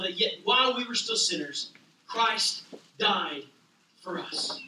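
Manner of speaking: dropping to a whisper on for us; hushed. Acted. Preacher quotes Romans to the congregation, fading to whisper. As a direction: fade into a reverent whisper. Quoting Romans, climactic hush.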